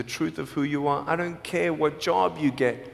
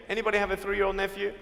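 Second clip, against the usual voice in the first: quick, quieter aside to the crowd; fast and softer; 5 dB quieter, much faster than usual. Real, not acted. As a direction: rattle off the repeat quickly. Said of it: "repeated fast and softer".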